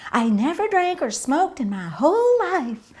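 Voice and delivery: high-pitched mock woman's protest; put-on high, emphatic voice; earnest lady impression. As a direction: mimic a lady earnestly protesting her innocence. Retold English lady protesting innocence.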